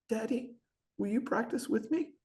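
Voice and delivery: put-on little girl asking her dad; timid daughter. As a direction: ask sweetly and timidly. Shy daughter asks dad.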